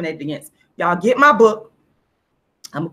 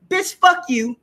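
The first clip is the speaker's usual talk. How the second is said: quick higher mock snap of contempt; dismissive attitude; 8 semitones higher, slower than usual. Voicing her inner attitude toward the relatives, higher mock contempt. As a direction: toss it off with playful scorn. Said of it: "my attitude says".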